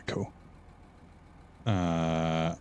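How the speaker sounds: ordinary talk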